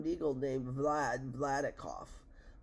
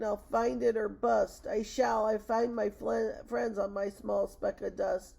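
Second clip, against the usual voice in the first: raised, slow, determined vow; crying out; 6 semitones higher, 5 dB louder than usual. A narrator's reading. Elephant swears to find friends on the speck, crying out. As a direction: declare it loudly against the odds. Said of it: "cried Horton".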